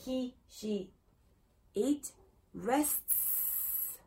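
"rests" with a long, clear final "ts"; careful pronunciation. Shown as a demonstration. Teacher models third person, careful "rests" with hissed ending. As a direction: separate each word, then sound out the "ts" ending "rests". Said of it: "tener mucho cuidado con esta pronunciación".

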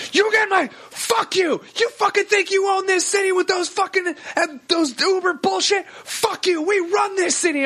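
high, strained cab driver tirade; imitated furious yelling. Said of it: "after you're yelling at me".

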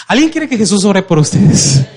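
loud, punchy question to the crowd; rousing call. Real, not acted. Preacher re-asks a half-hearted congregation who wants prayer, loud and rousing. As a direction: raise the voice to demand a response.

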